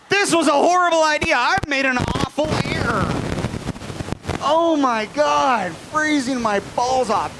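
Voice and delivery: high-pitched, hammed-up regret; freezing misery. Man left out of the hot water, high-pitched complaining. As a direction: wail it as if freezing.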